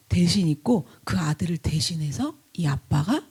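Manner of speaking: solemn whispered narration; hushed storytelling. Acted. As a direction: drop to a whisper for the verdict. Hushed telling of substitution. (엄숙하게 속삭이는 이야기)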